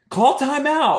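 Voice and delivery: high, loud yell across the gym; yelling coach. Retold or imitated. Rival coach yells at the dad to stop the game, high and loud. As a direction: bark it at the other bench. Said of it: "starts yelling at me".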